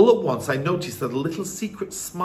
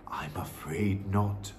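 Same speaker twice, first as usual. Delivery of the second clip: low, soft, slow grandmother; hushed old grandmother voice; gentle regret; 7 semitones lower, 8 dB quieter, slower than usual. Acted. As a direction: break bad news very quietly. Grandmother gently breaks bad news.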